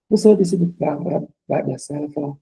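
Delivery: ordinary delivery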